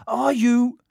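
can't believe it's really him; awed question. A narrator's reading. Twin asks the famous boy, high and amazed.

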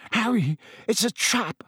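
high, strained warning; pained. A narrator's reading. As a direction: force the warning out through gritted teeth. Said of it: "His teeth were gritted with pain".

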